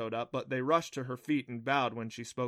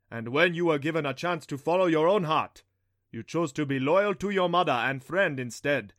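loud, raised, resounding god voice; thunder god proclaiming; 4 semitones higher, 7 dB louder than usual. A narrator's reading. Thunder god praises Adam's faith and loyalty, proclaiming. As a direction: proclaim it grandly, with full force.

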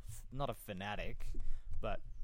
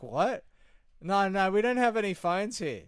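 higher, louder disbelieving echo of the line; incredulous caller; 9 semitones higher, 12 dB louder than usual. Retold or imitated. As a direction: imitate the stunned caller, pitch up and loud.

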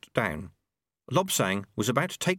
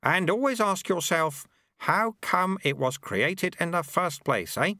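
put-on a higher, sly old monk voice; old sweeper Lutze; 8 semitones higher than usual. A narrator's reading. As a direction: tease him like a cheeky old master.

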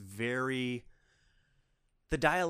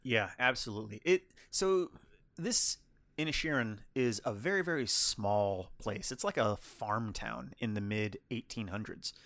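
normal talking voice

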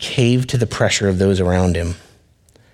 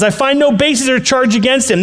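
Pilate's voice; 13 semitones higher, 3 dB louder than usual. Acted. Pilate pleads third time.

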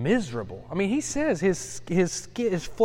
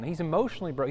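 ordinary talk, nothing marked

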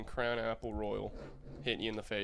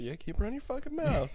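higher, cocky taunting voice; mock bar tough taunt; 10 semitones higher than usual. A teller playing a gloating bar brawler, mock cocky. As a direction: gloat at the guy you just knocked out. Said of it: "be like".

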